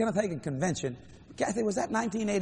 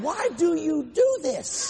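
high, drawn-out mock wail; exasperated wail; 10 semitones higher, 4 dB louder, much slower than usual. Preacher complaining to God about giving, exasperated wail. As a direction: wail it slowly in protest.

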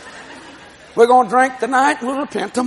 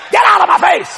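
higher, louder dismissal; mock angry shout; 8 semitones higher, 4 dB louder than usual. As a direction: bark it like an annoyed wife. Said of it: "she's still mad".

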